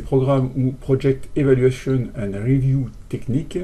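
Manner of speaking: English acronym read with a strong French accent. Real, not acted. Teacher reading the English term to students, in French-accented English. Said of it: "Excusez-moi pour mon accent".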